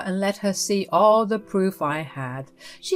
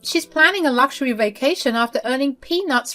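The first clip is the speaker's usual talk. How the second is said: higher, sharp mother-in-law demanding answers; 5 semitones higher than usual. Acted. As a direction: play the meddling mother-in-law, higher pitched.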